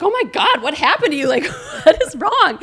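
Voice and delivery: loud, high-pitched mock alarm; hammed-up shocked outburst, near laughter; retold alarm. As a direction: imitate a panicked husband, laughing through it. Husband alarmed at her bawling.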